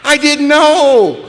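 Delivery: very high, loud, slow squeaky excitement; the puppy bursting with joy; high, slow, squealing voice of the thrilled puppy; overjoyed puppy voice. Retold or imitated. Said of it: "He'll go".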